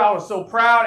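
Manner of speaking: ordinary talk, nothing marked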